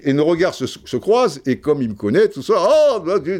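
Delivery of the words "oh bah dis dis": high, clumsy mock Belgian exclamation; bad Belgian accent. Belgian coach recognising the speaker, in a badly faked Belgian accent. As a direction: badly imitate an excited Belgian greeting. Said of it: "je sais pas bien faire l'accent belge".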